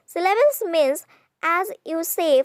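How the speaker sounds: ordinary talk, nothing marked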